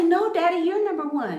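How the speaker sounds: high-pitched little daughter protesting; voicing her child self. Little girl answering Daddy back, high imitated protest. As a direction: play a small girl insisting to her dad. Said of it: "I would say".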